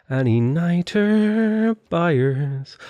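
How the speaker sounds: long, high, drawn-out vendor cry; hawker's call. Pretend. Player hawking niter to imagined traders, drawn-out call. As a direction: stretch it out like a street seller calling.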